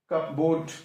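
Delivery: cupboard as "cup-board" with the p pronounced; sounded P. Shown as a demonstration. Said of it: "Why we not call". (cupboard में P बोलकर)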